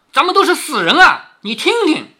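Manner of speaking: high, loud exasperated outburst; indignant complaint. Acted. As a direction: turn to a confidante in outrage. 凤姐向平儿抱怨被瞒. (气愤地高声抱怨)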